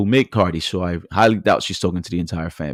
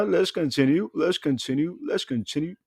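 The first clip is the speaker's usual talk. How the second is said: each repeat softer until nearly gone; fading repeat; 7 semitones higher, 7 dB quieter than usual. Real, not acted. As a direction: let it trail off into silence. Repeats while fading away.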